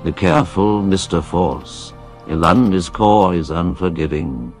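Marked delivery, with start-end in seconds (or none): none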